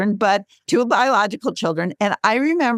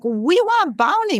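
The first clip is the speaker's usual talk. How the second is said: put-on high, slow small-child voice, R's dropped; little daughter's lisp; 10 semitones higher than usual. Mother imitating her whining toddler, high and slow. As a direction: mimic a toddler whining for Barney. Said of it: "couldn't say her R's".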